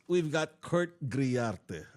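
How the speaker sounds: normal talking voice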